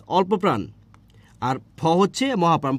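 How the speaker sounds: ordinary talk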